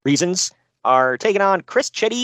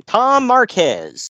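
slow, higher, louder disbelief; exasperated drawl; 6 semitones higher, 4 dB louder, much slower than usual. Shown as a demonstration. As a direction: stretch the name out in dismay. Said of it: "Good grief".

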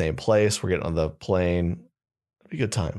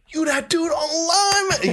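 very high, slow, laughing fan voice; excited fan; slower than usual. Retold or imitated. Airport worker recognizes the comedian, very high and laughing. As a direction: squeal it out like a thrilled fan. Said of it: "he's like".

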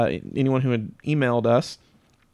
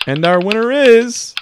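higher, louder drumroll build-up; hyped giveaway announcement; 9 semitones higher, 6 dB louder than usual. Acted. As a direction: announce the winner like a game show host. Spinning the giveaway wheel.

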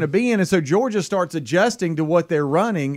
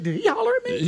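squeaky offended high voice; high mock voice; 4 dB quieter than usual.

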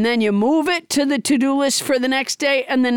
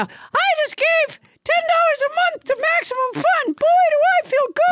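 balloon animal voice. Acted.

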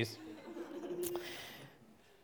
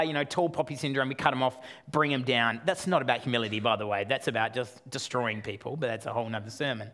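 ordinary talk

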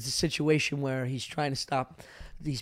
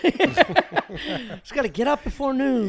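laughing mock resolve; 8 semitones higher, 5 dB louder, much slower than usual. Joking about lazy writer.

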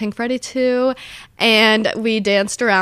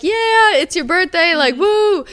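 much higher mock party cheer; imitating staff whooping for a birthday, high; hyped cheering; 9 semitones higher, 4 dB louder, slower than usual. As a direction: cheer it like a hyped club worker. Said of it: "the workers were like".

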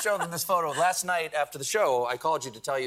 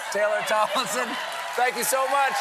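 shouted showbiz announcement, higher and louder; announcer send-off; 11 semitones higher, 7 dB louder than usual. Acted. Host thanking Taylor and announcing the premiere, booming announcer. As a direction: sell the premiere to the crowd.

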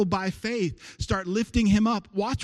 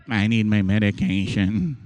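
grumbling congregant; 10 semitones lower than usual. Pretend. Mocking an unwilling congregant.

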